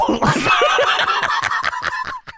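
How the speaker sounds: cracking up. Real, not acted. Big laugh at the joke.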